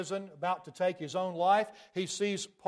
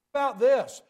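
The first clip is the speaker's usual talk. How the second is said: raised challenge; 6 semitones higher, 8 dB louder than usual. Real, not acted. Preacher challenges congregation.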